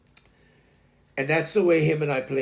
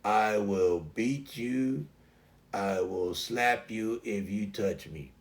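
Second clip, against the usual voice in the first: low, surly dog voice; grumpy leave-me-be attitude, understated; Taz; 6 semitones lower, 5 dB quieter than usual. Retold or imitated. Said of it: "And then Taz was like".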